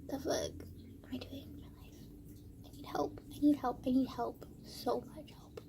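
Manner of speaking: half-whispered plea to oneself; despairing whisper. Real, not acted. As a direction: let it fade into a breathy whisper. Despairing aside to herself.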